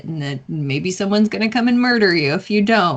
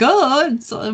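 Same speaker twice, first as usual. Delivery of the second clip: higher, louder mock blurting; mock gossip; 7 semitones higher, 5 dB louder than usual. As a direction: imitate someone eagerly spilling gossip. Mimics gossiping random people.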